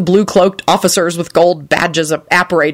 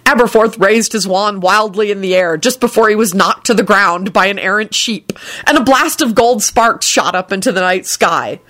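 ordinary delivery

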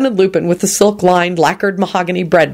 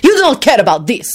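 high, indignant accusation; wrathful; 7 semitones higher than usual. A narrator's reading. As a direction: accuse him in contained wrath. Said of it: "he announced wrathfully".

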